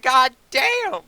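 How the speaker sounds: high, loud, amazed shout of disbelief; amazed exclamation. Retold or imitated. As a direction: blurt it out, stunned by the jump. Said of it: "I was like".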